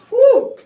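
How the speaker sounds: a high, loud whoop of celebration; bright celebratory whoop; high cheer. Real, not acted. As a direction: whoop for the finished set. Cheering after ten layups.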